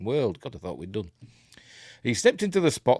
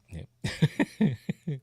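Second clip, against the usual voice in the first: ordinary talk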